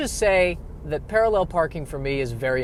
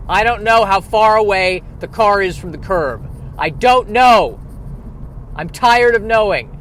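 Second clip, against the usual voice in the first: louder, higher exasperated complaint; rising frustrated rant; 5 semitones higher, 6 dB louder than usual. Real, not acted. Ranting to viewers about parallel parking, louder exasperation. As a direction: throw up your hands, exasperated.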